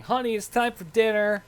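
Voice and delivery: lightly voiced woman calling from upstairs; ghostly woman calling. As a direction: imitate an unseen wife calling the family in. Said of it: "a woman's voice call out saying".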